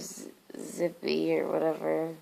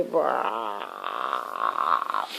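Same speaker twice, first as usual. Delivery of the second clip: zombie groan. Pretend. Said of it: "like I'm a zombie".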